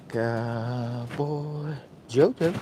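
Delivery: slow, stretched-out Cowboy Jojo drawl; lightly voiced slow persona, dragging the name; odd put-on voice. Introducing himself to Australian friends in a slow, stretched put-on voice. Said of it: "What is wrong with his voice?".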